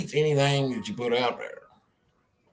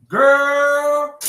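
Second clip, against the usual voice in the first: calling out; 10 semitones higher, 8 dB louder than usual. Acted. Calling pet.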